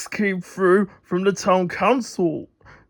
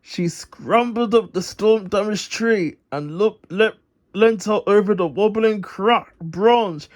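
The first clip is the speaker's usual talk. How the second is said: usual speaking voice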